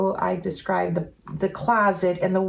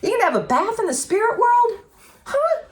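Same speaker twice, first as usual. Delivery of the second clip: much higher, amazed disbelief; incredulous; 4 dB louder than usual. Retold or imitated. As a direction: squeak out the disbelief. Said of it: "my logical brain was like".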